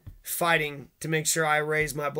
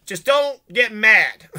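mock shout; 5 semitones higher, 10 dB louder, much slower than usual. What do you mean?.

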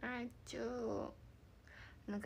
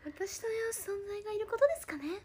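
higher, put-on teasing voice; high mock voice; 9 semitones higher, 5 dB louder than usual. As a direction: mimic her line in a squeaky voice. 相手の言葉を真似る. (高い声でからかうように)

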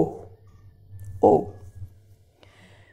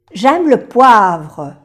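"J'aime le poivre" very slowly, "J'aime" stressed; slow demo sentence; 5 dB louder, much slower than usual. Shown as a demonstration. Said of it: "Me gusta la pimienta".

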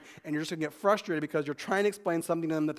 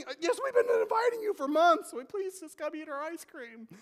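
exasperated pastor; 11 semitones higher than usual. Acted.

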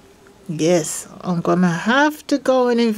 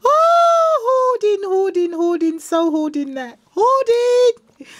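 very high, gushing delight; overjoyed squealing; 11 semitones higher, slower than usual. Real, not acted. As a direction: squeal greedily over new treasures. Overjoyed, claiming gifts for hoard.

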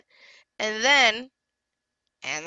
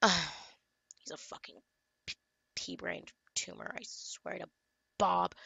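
muttered insult; 2 semitones lower, 11 dB quieter than usual. Real, not acted. Muttering insult after outburst.

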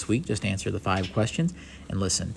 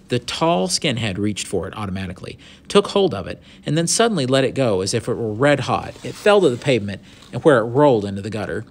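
animated storybook reading, lively pitch; story narration; 5 dB louder than usual. A narrator's reading. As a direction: read the adventure out with expression. Reads the story aloud.

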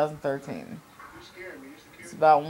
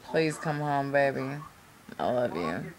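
sweet nothings; 2 semitones lower, 5 dB quieter than usual. Real, not acted. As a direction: fade to a soft, tender goodnight. Tender goodnight to boyfriend.

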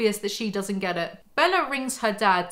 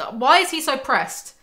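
exasperated; 5 semitones higher, 5 dB louder than usual. Real, not acted. Exasperated rhetorical outburst.

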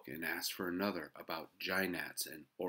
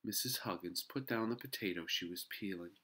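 ordinary delivery, nothing marked

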